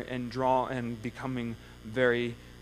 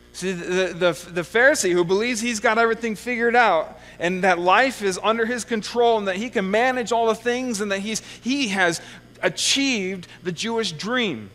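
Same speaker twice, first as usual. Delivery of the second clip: louder, higher, building indictment; rising preaching fervor; 5 semitones higher, 5 dB louder than usual. Real, not acted. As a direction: drive the point home at full voice. Preacher builds against the Pharisee.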